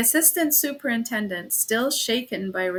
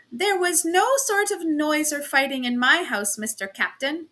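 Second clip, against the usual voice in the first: put-on German accent; 5 semitones higher than usual. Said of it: "she pattered all at once ... strong German accent".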